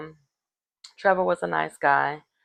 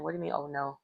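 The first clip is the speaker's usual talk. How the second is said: quiet aside; 3 semitones lower, 13 dB quieter, much faster than usual. Pretend. Inner thought, much softer.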